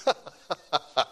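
real chuckle; short pleased laugh at his own story; amused laugh. Real, not acted. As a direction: chuckle to himself. Amused after prison card story.

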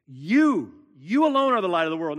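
"You" punched loud and set apart, then a quick, forceful run from "you alone"; stress on YOU. Shown as a demonstration. Preacher drives home who the light is, punching "You". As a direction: hit "You" hard, pause, then drive "you alone" on loudly. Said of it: "The emphasis is on you".

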